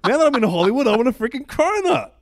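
high, loud, laughing plea; giddy demanding. Pretend. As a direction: beg for it like a giddy kid. Mock demanding cronuts, laughing.

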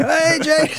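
mates cheering from the corner; retold call-out. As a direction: schoolmates yelling at the learner driver.

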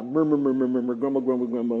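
low, droning grumble; mock murmuring. Preacher mimicking complainers under their breath, murmuring. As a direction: run the words together in a low mumble. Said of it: "doing it under your breath".

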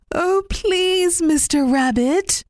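high, polite begging; pleading old woman. Acted. Old woman asking Mister Rabbit for his basket, mildly pleading. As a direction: ask sweetly for the basket.

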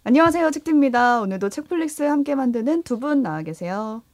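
podcast host. Acted. Podcast opening retake.

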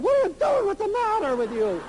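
put-on high, alarmed kid voice; jolted awake, protesting; startled kids. Kids woken by shaking mother, high startled cry.

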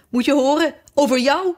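high-pitched, lightly crowing announcement; triumphant exclamation. A narrator's reading. Fiedeldop reveals who the king dreams of, triumphant. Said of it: "riep Fiedeldop uit, triomfantelijk in zijn handen klappend".

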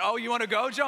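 a show of toughness to hide fear; fake tough bravado. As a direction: bluff a fight like a small dog acting hyped. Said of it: "I'm acting like I wanna keep going".

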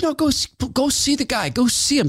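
high put-on voice urging him on; imitating Dave. Dave urging Todd to go meet Glenn, in a high put-on voice. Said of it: "And Dave said".

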